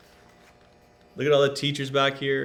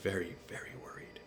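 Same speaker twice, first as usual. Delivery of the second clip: fading to a whisper; 3 semitones lower, 14 dB quieter than usual. A narrator's reading. Narration of worry fading out.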